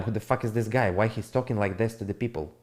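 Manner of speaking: ordinary talk, nothing marked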